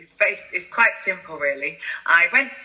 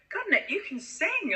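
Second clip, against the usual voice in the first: impressed praise; 12 semitones higher, 6 dB quieter than usual. Retold or imitated. People praising her singing.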